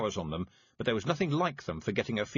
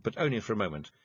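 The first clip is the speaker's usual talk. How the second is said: plain reading voice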